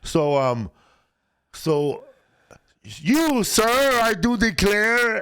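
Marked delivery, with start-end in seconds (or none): 2.8-5.2 high, slow Southern drawl; hammed-up Southern gentleman; Southern accent. S0 voicing Office characters in a Southern accent. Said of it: "Everybody speaking a Southern accent".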